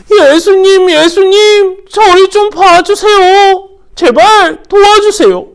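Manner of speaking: high, loud, slow repeated cries; shouting. Acted. Blind Bartimaeus still shouting to Jesus, desperate. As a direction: keep calling out for help. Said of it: "계속해서 바디메오는 소리치고".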